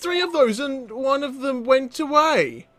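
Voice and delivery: high, stammering indignation; baffled protest. Real, not acted. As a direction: protest in disbelief, pitch well up. Upset that an ingot has vanished.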